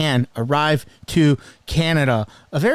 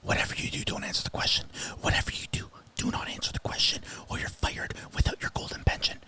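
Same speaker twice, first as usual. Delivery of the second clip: conspiratorial whisper, softer, urging; mock whisper; 13 dB quieter, much faster than usual. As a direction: hiss the warning like a handler coaching the minister. Mock voice of someone coaching the minister.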